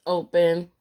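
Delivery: normal talking voice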